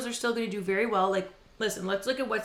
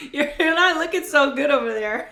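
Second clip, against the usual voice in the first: laughing; 5 semitones higher, 6 dB louder than usual. Real, not acted. Teasing while laughing.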